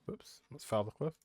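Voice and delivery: ordinary talk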